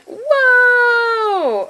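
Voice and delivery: high, loud delighted gasp; surprised whoa. Real, not acted. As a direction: burst out in surprise. Opening the chocolate box.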